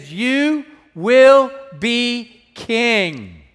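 very slow, drawn-out prophetic declaration; Jonathan declaring. Acted. Jonathan telling David he will be king, slow and solemn. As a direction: stretch each word like a solemn promise. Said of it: "He said".

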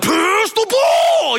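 high, slow sideline shout; yelling from the stands. Retold or imitated. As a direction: bellow at the court like a frustrated sports dad. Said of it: "and not yell".

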